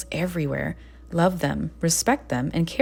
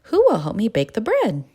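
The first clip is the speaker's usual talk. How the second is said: high, louder hopeful question; eager little hen; 6 semitones higher, 5 dB louder than usual. A narrator's reading. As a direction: ask the barnyard brightly for help. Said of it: "the little red hen asked".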